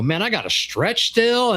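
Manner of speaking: whiny excuse. Retold or imitated.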